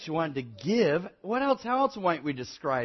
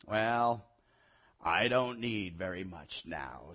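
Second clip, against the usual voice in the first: put-on low, soft, worn-out old voice; weary old man; 7 semitones lower, 5 dB quieter, slower than usual. A narrator's reading. Old boy asks little of the tree, weary. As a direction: speak low, with little strength left.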